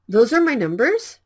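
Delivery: "Those are my numbers?" with the tune rising on numbers; question rise. Shown as a demonstration. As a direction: lift the end into a question. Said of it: "If you raise the intonation".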